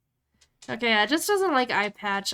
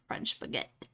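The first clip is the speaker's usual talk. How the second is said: "French baguette" whispered and much softer; whispered repeat; 6 semitones lower, 10 dB quieter than usual. Real, not acted. Repeating the chat's idea to herself, whispered. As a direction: breathe "French baguette" to yourself. Repeating the suggestion under her breath.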